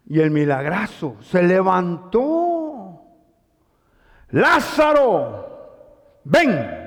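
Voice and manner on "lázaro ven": very slow call of the name, then a short command. Retold or imitated. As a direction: summon him out of the tomb. Jesus calling Lazarus from tomb. (llamado lento y solemne, «Lázaro» alargado)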